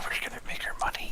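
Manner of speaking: hushed, conspiratorial quip in a whisper; under-the-table whisper to a fellow player; whispered aside. Real, not acted.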